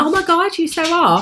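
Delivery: high, mock-outraged teasing back; playful exasperation. Real, not acted. To her husband teasing the dog: high mock outrage.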